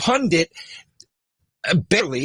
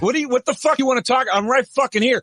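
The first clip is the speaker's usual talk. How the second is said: retold challenge; 9 semitones higher than usual. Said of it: "And I go".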